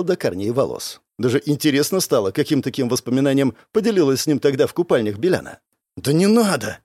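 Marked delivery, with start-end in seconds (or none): 6.0-6.8 husky, embarrassed plea; hoarse. A narrator's reading. Blushing lad stopping the girl's secret, hoarse. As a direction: plead it hoarsely. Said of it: "хрипло сказал паренёк".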